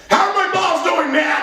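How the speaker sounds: high-pitched, exasperated shout; exasperated mock outburst. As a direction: burst out at the pointless small talk. Exasperated patient snaps at small talk.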